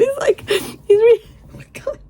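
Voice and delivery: high, squeaky and overcome; flustered squeal. Real, not acted. Overcome by the scene.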